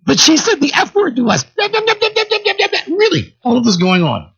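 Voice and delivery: put-on high, whiny teen protest; a shrill girlish complaint way up high; whiny teenage girls. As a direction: squeal it like an offended teen girl. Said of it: "And they say".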